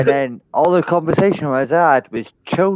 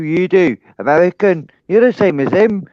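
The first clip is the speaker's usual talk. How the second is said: normal talking voice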